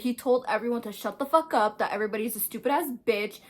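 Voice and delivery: usual speaking voice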